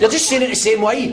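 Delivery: high-pitched exasperated complaint. Acted. Exasperated row in stuck lift.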